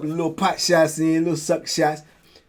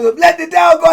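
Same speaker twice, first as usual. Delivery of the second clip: higher, louder barked order; guard's shout; 10 dB louder than usual. Retold or imitated. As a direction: shout it back over the chaos. Guards yelling back.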